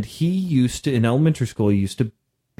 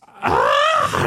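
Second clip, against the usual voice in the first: short mock scream, very high; imitated scream. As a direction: mimic a stabbed kid's scream. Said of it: "he starts screaming".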